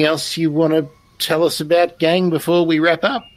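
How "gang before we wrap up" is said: ordinary talk, nothing marked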